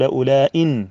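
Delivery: "أولئك" said as ulaa-in, ending in a clear n; with tanween. Shown as a demonstration. Teacher giving the second dialect, "أولئك" with nunation. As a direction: close "أولئك" on hamza, i and n, no k. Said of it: "أن تقول أولئك بالتنوين".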